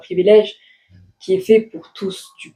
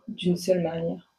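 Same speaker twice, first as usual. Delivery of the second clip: normal talking voice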